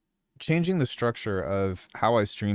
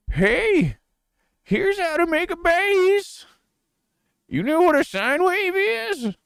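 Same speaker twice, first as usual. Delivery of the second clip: put-on much higher, slow creaky old man voice; 4 dB louder, slower than usual. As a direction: play a doddering old teacher, slowly and high. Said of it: "narrate with old man voice".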